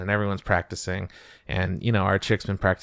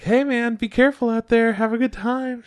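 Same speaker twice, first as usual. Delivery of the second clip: pitched way up, light and friendly; high girly voice; 13 semitones higher than usual. Retold or imitated. Bandmate seeing her off, high girly voice. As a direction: chirp a sendoff up high. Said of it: "some chick's like".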